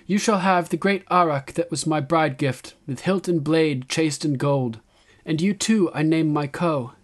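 high, loud address to her people; young queen's proclamation. A narrator's reading. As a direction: proclaim it to a whole crowd.

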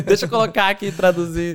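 fala no meio da risada; rindo. Real, not acted. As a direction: rir enquanto brinca de traduzir. Rindo da própria história. (entre risos, brincando com a entrevista)

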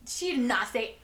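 loud, slow indignant protest; loud, stunned indignation; outraged disbelief. Retold or imitated. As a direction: object to the lie, slowly and loudly. Staff outraged at customer's lie.

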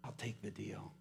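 subdued, low retold tearful surrender; very soft, understated giving in. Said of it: "he burst into tears and he said".

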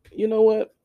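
mock firm refusal. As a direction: snap to attention before saying no.

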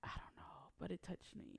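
hushed spooky whisper. Real, not acted. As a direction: whisper it like a ghost secret. Eerie ghost story detail.

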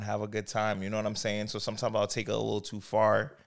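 normal talking voice

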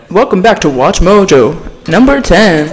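loud mock announcer voice; punchy parody of a video intro; countdown video host.

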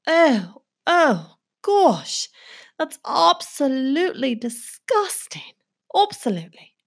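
a light put-on British accent, reacting to sounds. [name] in a British accent reacts to sound effects with mock disgust. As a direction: play a posh English lady, appalled. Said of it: "the British [name]".

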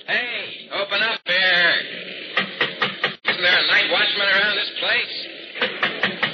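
high, drawn-out shout for attention; calling out. Acted. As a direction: call out to be let in through a shut door. Calling through locked doors for watchman.

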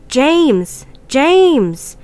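high, drawn-out call into the distance; hollering. Acted. Two friends calling out for missing James, hollering. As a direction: holler the name as if searching in the dark. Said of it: "We both hollered out into the darkness repeatedly".

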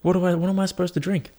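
high, fast, frantic whining; rising mock desperation, quick and high; mock panic. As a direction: flap about the missing drink. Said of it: "I'm like".

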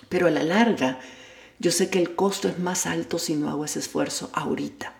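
ordinary delivery

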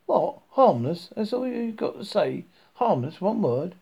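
outraged disbelief. Acted. Arthur protests to Ford about the one-word entry, outraged and loud. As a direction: fling the words back in disbelief.

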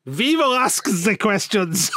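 slow mock German interrogator; German accent. German knock-knock joke in a slow mock German accent. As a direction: draw it out in a put-on German accent.

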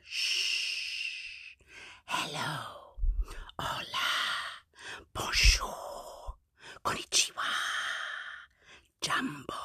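soft whispered greetings; whispering. Shown as a demonstration. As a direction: whisper each hello gently. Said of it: "as softly as we".

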